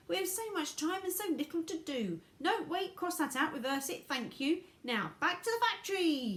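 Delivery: high, excited character voice; Mister Wonka in a hurry. Acted. Wonka urges them back to the factory, high and excited.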